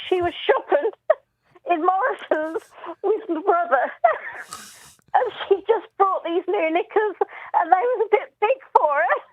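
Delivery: high, giggly voice barely holding it together; telling through laughter. Real, not acted. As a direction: struggle to get the story out for laughing. Struggles through laughter retelling funny bit.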